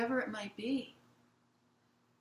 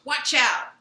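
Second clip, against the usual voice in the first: loud, grumpy warning shout; grumpy old man; 5 semitones higher, 13 dB louder than usual. Retold or imitated. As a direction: call out like a cross old man. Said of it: "the old man goes".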